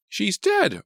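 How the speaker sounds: high loud panicked shout; high accusing shout. A narrator's reading. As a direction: shout it at him. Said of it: "Artie shouted".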